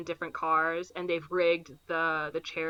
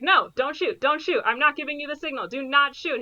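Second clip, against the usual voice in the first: higher, urgent don't shoot; urgent warning; 7 semitones higher than usual. Retold or imitated. Partner pleads against shooting, higher and urgent. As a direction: stop him fast and firmly.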